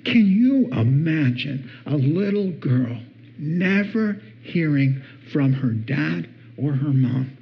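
ordinary talk

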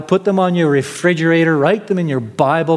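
normal talking voice

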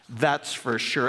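ordinary delivery

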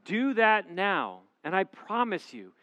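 normal talking voice

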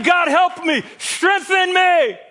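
hammed-up desperate prayer; shouted plea. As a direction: beg God for strength at the top of the voice. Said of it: "I'm saying".

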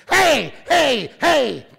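high, loud shouted hey; dog shouting. Acted. Collared dog yelling at the scientist, high and loud. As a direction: yell it like an excited dog. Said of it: "the dog says".

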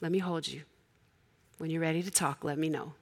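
tender mom. Retold or imitated. Held her.

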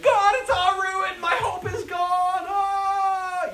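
high, slow hammed-up wailing; melodramatic despair. Joshua moaning to God that all is lost, hammed-up. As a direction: ham up a hopeless wail to God. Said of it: "Joshua's like".

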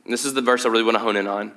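ordinary delivery

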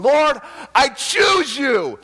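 high, slow, full-voiced shout to God; loud, ecstatic scream of devotion; screamed declaration. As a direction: cry it out with all you have, drawing out each word. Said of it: "then I started screaming".

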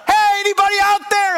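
high-pitched call for help; yelling out. Retold or imitated. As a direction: holler out to the whole neighborhood. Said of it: "I'm, like, yelling out".

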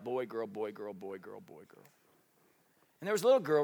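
usual speaking voice

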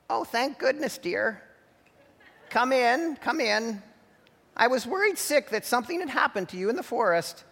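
put-on high, fussing old grandma voice; worried grandma. A narrator's reading. Grandma welcomes who she thinks is granddaughter, high and anxious. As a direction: fret over the late granddaughter.

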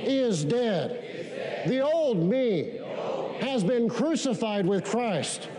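slow preaching cadence. Acted. Preaching repeated declaration.

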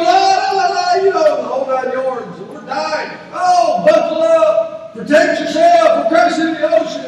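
high, loud mock-panicked gabble; frantic pilot. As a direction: imitate a pilot shouting frantic crash warnings. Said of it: "pilot begins to start in a very frantic voice".

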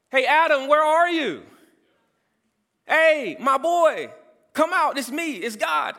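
high, loud call to a son; yelling out. Retold or imitated. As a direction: call out across a garden for someone hiding. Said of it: "yelling out to his son".

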